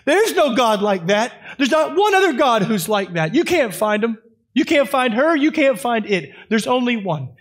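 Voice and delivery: loud, high climactic sermon delivery; impassioned pulpit proclamation; full-force preaching. Acted. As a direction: drive it home to the congregation.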